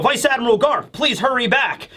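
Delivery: high, pressing call to the vice admiral; urgent soldier's plea. Retold or imitated. Marines calling to Garp.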